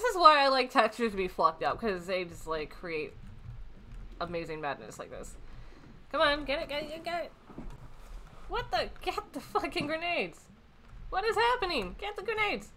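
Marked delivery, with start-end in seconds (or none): 6.1-12.7 high-pitched, softer panicky pleading at the game; frantic urging. Real, not acted. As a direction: urge them on in a high squeak. Urging frantically during game action.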